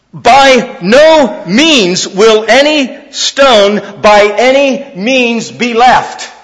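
slow, heavy emphasis on By no means and by any means; emphatic, slow. Acted. Preacher stressing Jesus's words to the congregation, slow and emphatic. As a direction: lean on every word of By no means. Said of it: "it's very emphatic".

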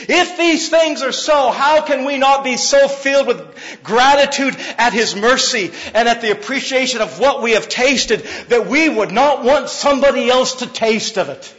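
high, loud, driving sermon voice; preaching at full force. Acted. Pastor preaching grace to church, loud and high. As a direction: preach it out with fire to the congregation. Building rhetorical questions.

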